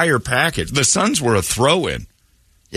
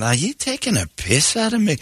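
higher, mock-offended Scottish quote; Scottish accent; 9 semitones higher than usual. Host retelling the Scot's catchphrase, in a light mock Scottish accent. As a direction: echo the Glasgow man's challenge, lightly mocking. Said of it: "repeating the Scot's line".

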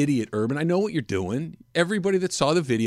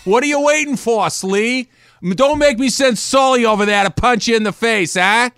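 put-on Boston accent; 9 semitones higher, 7 dB louder than usual. Host heckling his co-host in a loud Boston accent. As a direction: go full Boston, needling your buddy. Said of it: "do the stupid accent".

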